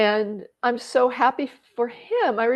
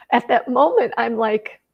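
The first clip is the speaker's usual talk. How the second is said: normal talking voice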